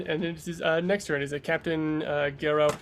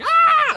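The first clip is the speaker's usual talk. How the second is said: very high, loud scream; 11 dB louder than usual. Acted. Game master as a spirit appearing next to the player, screaming. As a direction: burst in with a piercing cry.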